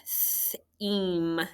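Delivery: Theme with a held "th", then "eem"; sounding out. Shown as a demonstration. Teacher asks about the word, sounding out.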